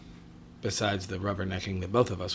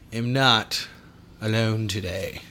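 ordinary talk

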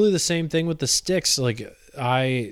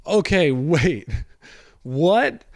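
higher, slow, drawn-out disbelief; stunned double take; 4 semitones higher, 4 dB louder, slower than usual. Retold or imitated. Canvasser hearing he was taken for a predator, slow stunned disbelief. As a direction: let the shock land on each word. Shocked at pedophile suspicion.

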